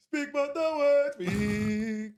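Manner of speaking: high, thin sung line; far-off singer. Retold or imitated. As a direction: sing it like a voice far from the mic. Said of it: "a guy not even on mic, like, being, like, far away going".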